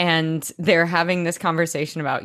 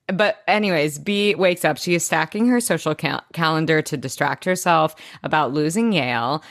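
ordinary delivery

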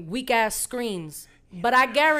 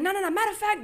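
higher-pitched indignant cut-in; retold anger; 9 semitones higher, faster than usual. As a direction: re-enact a mad interruption, pitch jumping up. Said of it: "I was so mad".

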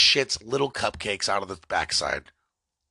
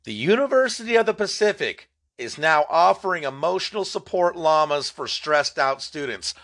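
incredulous repeat; 6 semitones higher than usual. Shown as a demonstration. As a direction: say it again like you can't believe it. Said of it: "Let me say it again".

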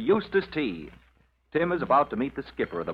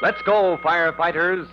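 louder, higher rallying cry; announcer's call to action; 5 semitones higher, 4 dB louder than usual. Acted. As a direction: fire up the audience.

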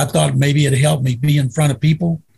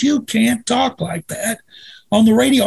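higher-pitched mock reprimand; retold scolding; 7 semitones higher than usual. Radio host scolds his guest for swearing on air, mock-exasperated. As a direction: act out an exasperated telling-off, pitched up.

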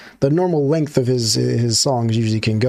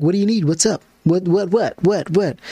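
higher, rapid what what what; mock annoyed parent; 5 semitones higher than usual. Parent stared at by kid, irritated. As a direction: pester like a confused grown-up. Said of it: "you'd be like".

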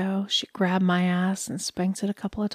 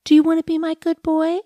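cooing pet talk; 10 semitones higher, 8 dB louder than usual. Acted. Dog lady cooing at captive.

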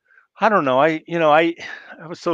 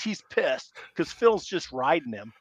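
normal talking voice

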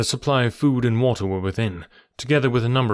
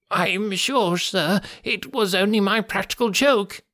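high, whimpering excuse; wretched, pitiful whine; 9 semitones higher, slower than usual. A narrator's reading. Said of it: "began to whimper".

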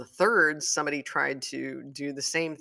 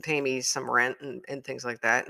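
usual speaking voice

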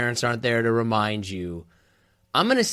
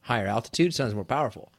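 ordinary talk

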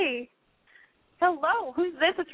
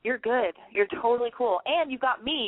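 usual speaking voice

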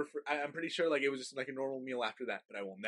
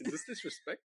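mock strict parent; 7 semitones higher, 5 dB quieter than usual. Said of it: "My parent".

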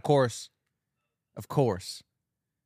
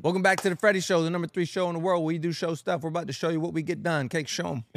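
announcer; 6 semitones higher, faster than usual. Acted. Show host opening intro.

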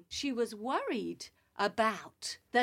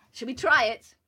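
ordinary delivery

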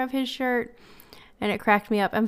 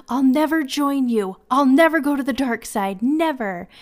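passionate defiance; 4 semitones higher, 7 dB louder than usual. Retold or imitated. Little nephew standing up to Darth Vader, passionate defiant shout. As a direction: plant your feet and shout it at the villain. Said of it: "he's just so passionately".

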